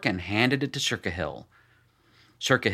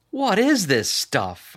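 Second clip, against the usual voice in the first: disgusted question; 10 semitones higher, 5 dB louder, slower than usual. Acted. Narrator after foul swig.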